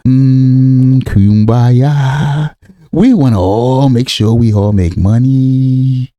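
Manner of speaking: slow, low, drawn-out singsong; mock-pious drone, stretching each line ending; mock kumbaya. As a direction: intone it like a dreamy communal chant. Mocks an idealistic community's kumbaya.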